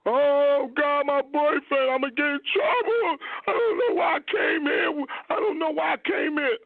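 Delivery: mock panicked wail, voice pushed up; imitating her panic. Man retelling his date's hotel breakdown, frantic high imitation. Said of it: "started crying and screaming".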